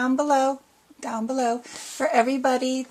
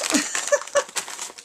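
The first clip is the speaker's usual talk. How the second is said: breathy giggle; 13 semitones higher than usual. Real, not acted. Giggling over candied popcorn.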